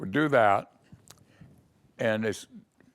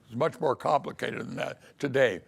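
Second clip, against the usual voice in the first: ordinary delivery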